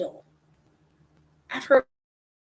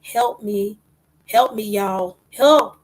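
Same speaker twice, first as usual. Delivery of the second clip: imitated cry for help, building louder; retold scream; 2 semitones higher, 8 dB louder, much slower than usual. Preacher voices people crying out, mock scream building. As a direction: quote the plea, rising to a loud last "help". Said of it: "people are screaming and saying".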